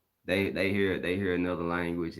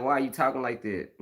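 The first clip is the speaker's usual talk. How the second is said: high, louder exasperated question; mad; 8 semitones higher, 5 dB louder than usual. Retold or imitated. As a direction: retell an irritated demand, voice climbing. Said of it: "it's made, making me mad".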